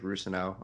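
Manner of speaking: "Rusano" as ROO-suh-no; "Rusano", first syllable stressed; own pronunciation. Shown as a demonstration. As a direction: say "Rusano" with a long oo first and a weak middle. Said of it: "how I was pronouncing it in my head".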